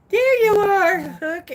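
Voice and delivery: very high, loud greeting; high calling out. Pretend. Host greets arriving viewers, high loud call. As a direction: sing it out high to someone just spotted.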